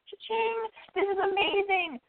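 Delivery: high, slow, gloating delight; gleeful cha-ching. Retold or imitated. Inner voice gloating at the driver's mafia past, high and thrilled. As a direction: squeal it like you hit the jackpot.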